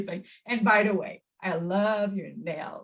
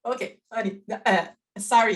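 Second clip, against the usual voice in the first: ordinary talk, nothing marked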